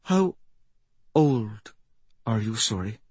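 softly voiced, halting question; a touch of tremble, with pauses between words; trembling voice. A narrator's reading. Old woman asking the mystic her age, mildly trembling. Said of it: "her voice trembling".

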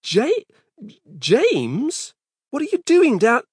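high, stammering disbelief; shocked. Acted. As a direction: gasp the name out. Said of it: "stared at his son with shock".

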